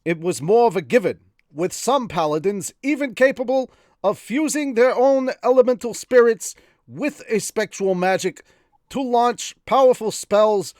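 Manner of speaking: high, loud put-on reading voice; character reading voice. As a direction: read the lore in the silly voice. Reads novel in put-on voice.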